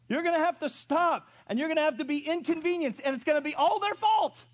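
high, loud insistent preaching; forceful preaching. Real, not acted. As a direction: drive the point home hard. Preacher answering the excuse.

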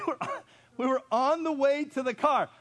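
high, loud excited telling; excited storytelling. Real, not acted. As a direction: build the tension. Building story climax.

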